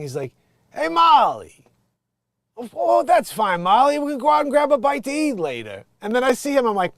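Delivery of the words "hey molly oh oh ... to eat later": high, loud booming sitcom-husband call; Billy Gardell's big voice. Retold or imitated. Imitating the comic's big sitcom voice to the audience. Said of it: "big talk thing".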